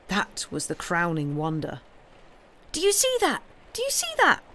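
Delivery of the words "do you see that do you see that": very high, thrilled yell. A narrator's reading. Pip yelling to the girls at the headless duck, very high. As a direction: shriek it out in wonder. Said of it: "yelled Pip".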